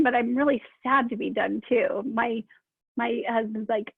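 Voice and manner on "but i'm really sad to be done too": normal talking voice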